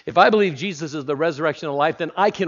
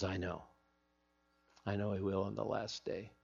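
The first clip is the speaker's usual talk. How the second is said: quiet Martha; 5 semitones lower, 16 dB quieter than usual. Acted. Martha answers Jesus, voiced.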